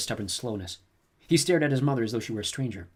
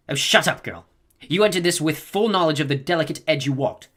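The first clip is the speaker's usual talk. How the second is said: a lightly voiced old woman, louder and impatient; sharp old woman; 6 semitones higher, 8 dB louder than usual. A narrator's reading. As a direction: cut her off like a tired elder. Old woman cuts Jessica off.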